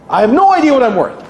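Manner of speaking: hammed-up flustered admission; mock anxious client. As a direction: play the clueless client. Client voiced in dialogue.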